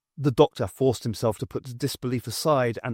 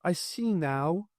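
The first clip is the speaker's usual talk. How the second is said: sing-song voice; 4 semitones higher, 5 dB quieter, slower than usual. Acted. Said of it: "Morag's sing-song voice".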